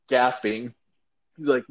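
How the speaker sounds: ordinary delivery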